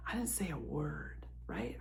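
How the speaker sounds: whispered confession; a secret whispered to viewers; hushed aside. Real, not acted. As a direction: drop to an unvoiced whisper. Confessing she kept quiet.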